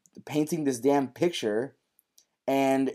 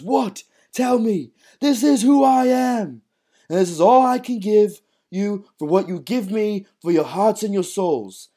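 acting out Tommy's speech; 10 semitones higher, 7 dB louder than usual. Podcaster retelling Tommy's speech to his family, high and intense. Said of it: "and he goes".

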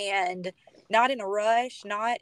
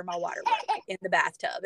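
ordinary delivery

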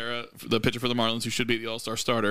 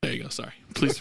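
ordinary talk

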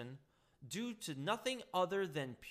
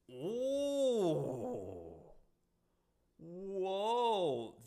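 mock shocked gasp; 4 semitones higher, 4 dB louder than usual. Reacting to bombshell quote.

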